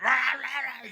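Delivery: high cartoonish pig roar; mock pig roars. Describing the Razorback film to friends, silly high pig roar. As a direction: roar like a giant movie pig, played for laughs.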